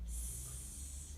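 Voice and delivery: a long, steady unvoiced hiss on the out-breath; hissed s sound. Shown as a demonstration. Therapist showing a client the breathing exercise, a steady hissing s. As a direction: let the breath out as one even s. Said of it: "we'll say".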